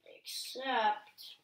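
usual speaking voice

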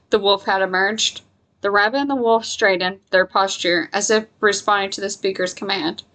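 ordinary delivery

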